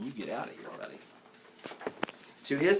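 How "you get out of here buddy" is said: very soft, offhand retelling; quiet aside. Retold or imitated. Reader explains the picture to listeners, speaking softly. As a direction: murmur the yelled line gently.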